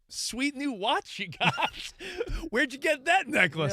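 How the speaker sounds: high-pitched playful ribbing; teasing mock admiration. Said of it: "I'm like".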